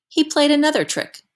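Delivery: plain reading voice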